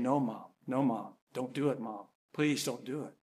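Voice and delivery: low, hushed begging; quiet pleading. Retold or imitated. Son begging his mother not to destroy him, quiet plea. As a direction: beg softly as if backing away. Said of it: "Chad begins backing up from the wall".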